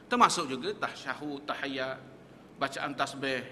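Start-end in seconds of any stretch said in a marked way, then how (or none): none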